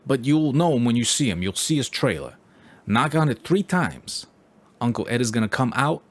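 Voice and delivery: confiding New York Italian paisan giving directions; New York Italian stranger sharing a secret route; New York Italian accent. Retold or imitated. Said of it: "he's like".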